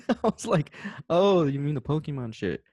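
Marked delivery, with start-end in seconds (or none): none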